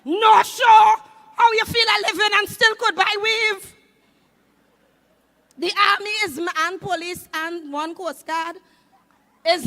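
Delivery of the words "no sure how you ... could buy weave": loud shout over the music; shout to crowd. Real, not acted. Comedian shouting to the crowd over music, loud shout.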